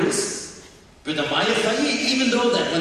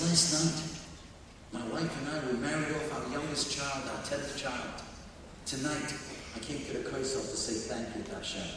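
low, soft retelling of a grateful father; hushed gratitude; 4 semitones lower, 10 dB quieter than usual. Retold or imitated. Father explains his prayer at the Kaisel, hushed gratitude.

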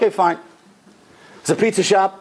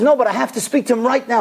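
insistent, a bit higher, mock demanding; raised voice; 6 semitones higher than usual. Customer insisting to the counter lady, voice raised. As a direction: push back hard in the retelling. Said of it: "And he raises his voice".

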